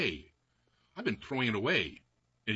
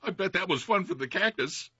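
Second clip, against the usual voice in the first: laughing; 7 semitones higher, 4 dB louder than usual. Real, not acted. Laughs at cactus story.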